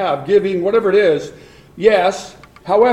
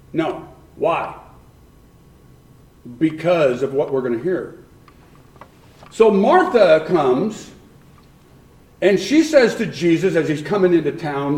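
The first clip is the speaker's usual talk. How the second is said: usual speaking voice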